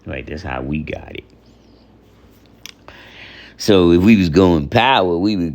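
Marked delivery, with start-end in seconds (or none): none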